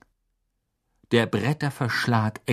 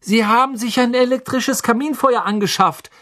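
high, raised voice; calling explanation; 13 semitones higher, 5 dB louder than usual. Acted. Harry explains through the boards, calling loudly.

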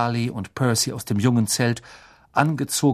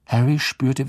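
plain reading voice

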